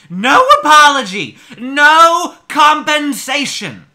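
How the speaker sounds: loud, high, indignant reading of a complaint; hammed-up indignation, stretched and loud; mock outrage. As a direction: belt each demand slowly, like an outraged customer. Mockingly reading an angry complaint.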